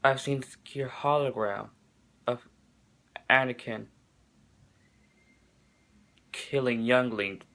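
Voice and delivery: halting, hushed mock-solemn line reading; low, soft voice with heavy pauses; Obi-Wan Kenobi. Reviewer quotes terrible dialogue from Obi-Wan, mock-solemn. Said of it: "especially coming out of Obi-Wan Kenobi".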